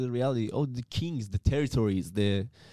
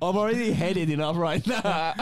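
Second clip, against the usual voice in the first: high, laughing protest; 9 semitones higher, 3 dB louder than usual. Real, not acted. Pleading with listeners not to hate him, laughing.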